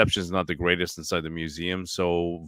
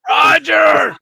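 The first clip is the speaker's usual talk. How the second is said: loud high call across the room; shouting a name; 14 dB louder than usual. Real, not acted. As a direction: yell his name to get his attention. Calling Roger from afar.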